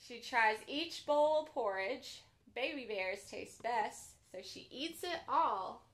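gentle bedtime storybook read; reading to children. A narrator's reading. Children's librarian reading a pop-up book aloud, gently.

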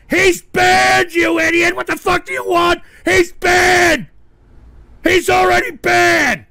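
loud, high-pitched angry shouting; exasperated yelling. Real, not acted. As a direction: yell at the idiot in total exasperation.

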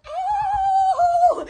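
mock yelp. Vlogger yelps in mock pain.

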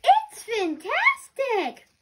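loud, slow, high delighted cry; thrilled shout. Acted. Narwhal loves the cookie: ecstatic shout. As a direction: burst out with delight at the taste.

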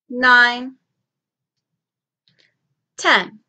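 teacher counting with the class; counting aloud. Shown as a demonstration. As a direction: count out each number clearly. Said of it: "Let's count to ten".

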